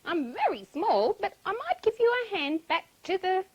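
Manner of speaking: high, earnest duck voice; lifted storybook bird voice; helpful duck. A narrator's reading. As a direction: offer help as a small duck. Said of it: "And he said".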